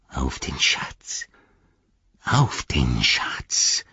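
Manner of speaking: slow, hushed, breathy creature rasp; Gollum, cowering. A narrator's reading. Gollum ducking before Frodo, repeating his oath, hushed rasp. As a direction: repeat it slowly, stretched out in awe.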